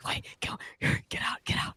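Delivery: urgent frantic whisper; whisper screaming. Retold or imitated. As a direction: hiss a panicked warning. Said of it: "I start whisper screaming".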